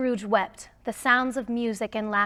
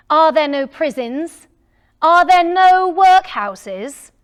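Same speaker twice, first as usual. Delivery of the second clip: loud, higher, slowing scoffing miser; scornful Scrooge; 6 semitones higher, 13 dB louder than usual. Acted. Scrooge scoffs at charity collectors.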